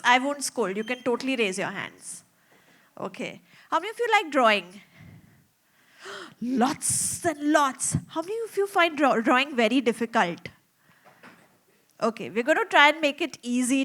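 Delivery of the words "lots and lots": gasp, then slow amazed words; mock amazement. Storyteller marvelling at the children's raised hands, slow and amazed. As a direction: act delighted at all the raised hands.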